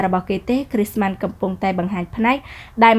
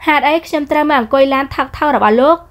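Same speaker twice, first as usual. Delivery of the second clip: higher, sharp retort from a proud heroine; haughty young woman; 5 semitones higher, 5 dB louder than usual. Acted. Heroine snapping back at the rude man, higher pitched. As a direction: toss it back at him, offended. Heroine scorns cheap car.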